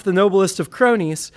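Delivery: usual speaking voice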